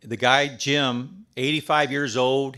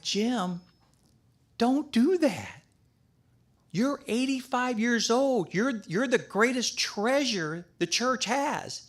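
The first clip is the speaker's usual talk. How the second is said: pitched up high, pleading and insistent; retold urgent plea; 8 semitones higher, 3 dB quieter than usual. Urging widowed golf partner Jim not to retire, high earnest plea. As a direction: beg him earnestly, voice climbing.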